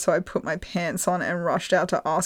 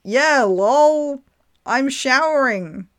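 higher, louder, a little slowed, playing it casual; sheepish mock answer; 6 semitones higher, 5 dB louder, slower than usual. Answering the girl from the shower, mock casual. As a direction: fake nonchalance. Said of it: "I then answer".

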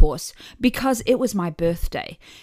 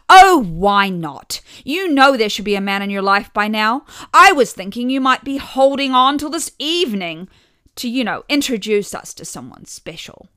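loud mother; 3 semitones higher, 9 dB louder than usual. A narrator's reading. Loud mother nagging daughter.

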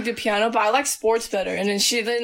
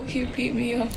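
ordinary talk

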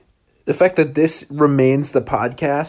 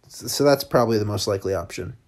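ordinary delivery

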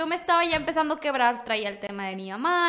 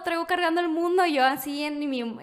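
usual speaking voice

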